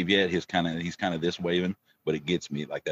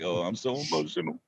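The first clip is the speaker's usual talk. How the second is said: mock emotional; 7 semitones higher, 3 dB quieter than usual. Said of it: "And now you're like".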